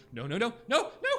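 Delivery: high, loud panicked no. Acted. Shopkeeper seeing goblin charge, panicked cry. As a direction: cry out in fear. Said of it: "And she".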